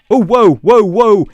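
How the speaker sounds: alarmed. Real, not acted. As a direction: back off in sudden alarm. Ram attacks him with potion effects.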